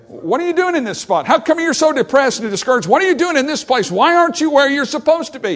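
high, loud, pressing questions; God confronting. Acted. God loudly confronting Elijah, demanding why he's hiding.

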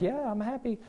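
a soft, bored reply; flat wife. Retold or imitated. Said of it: "she's like".

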